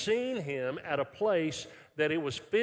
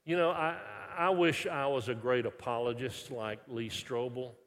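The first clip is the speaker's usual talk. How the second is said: ordinary delivery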